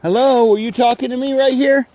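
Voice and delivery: loud, high greeting to someone unseen; loud call. Real, not acted. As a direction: call out friendly into the woods. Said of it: "why I talk so loud when I'm talking to them".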